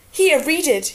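eager urging. A narrator's reading. Jon thrusting the letter at Tyrion, excited urging. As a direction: press it on them eagerly.

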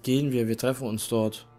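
ordinary talk, nothing marked

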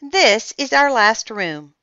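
high, loud presentation; grand announcement. A narrator's reading. Olivia showing Ian the last room, loud announcement. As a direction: present it like a proud hostess. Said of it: "Olivia announced".

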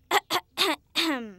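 showy throat clear before singing. Acted. Getting ready to show a friend some singing, throat clearing. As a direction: clear the throat in little bursts. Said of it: "show you how well I can sing".

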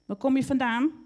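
loud, high, cross demand; snappish anger. A narrator's reading. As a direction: challenge the stranger angrily. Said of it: "vroeg ze nijdig".

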